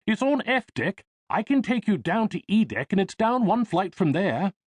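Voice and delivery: put-on young English accent, high and helpful. English lift boy directing Jack to the pool in an English accent. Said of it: "he replied in an English accent".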